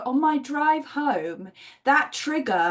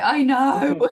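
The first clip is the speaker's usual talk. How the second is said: louder, laughing "I know"; sheepish laugh while agreeing; laughing admission; 4 semitones higher, 7 dB louder than usual. Real, not acted. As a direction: admit it through a laugh. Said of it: "I'm like".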